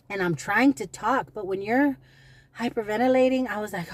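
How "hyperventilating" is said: normal talking voice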